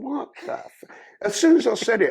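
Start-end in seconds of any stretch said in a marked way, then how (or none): 0.0-0.8 shocked thought. Retold or imitated. Inner thought at botched robbery.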